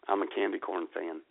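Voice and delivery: normal talking voice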